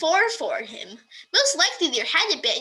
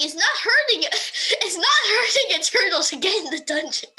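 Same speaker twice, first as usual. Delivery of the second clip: laughing; 6 semitones higher, 4 dB louder than usual. Real, not acted. Laughing while dictating dialogue.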